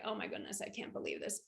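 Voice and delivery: hushed, stunned whisper; shocked whisper. Retold or imitated. Woman whispering in shock as her marriage ends. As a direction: breathe it out in disbelief.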